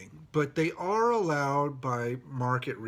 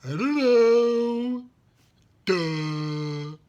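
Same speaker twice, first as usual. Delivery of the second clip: mock dismissive; 7 semitones higher, 6 dB louder, much slower than usual. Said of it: "They're like".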